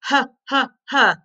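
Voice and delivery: "H" as a short huh, not the letter name; H sound. Shown as a demonstration. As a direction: puff out each "H" as a separate huh. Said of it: "what's the H sound".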